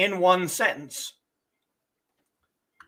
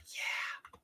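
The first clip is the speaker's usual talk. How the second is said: whispered aside; 15 dB quieter than usual. Real, not acted.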